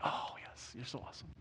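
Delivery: breathy whispered mock praise from his wife; unimpressed wife. Pastor mimics his wife flatly praising him, whispered. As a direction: hush it, deadpan and unimpressed. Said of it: "She'd be like".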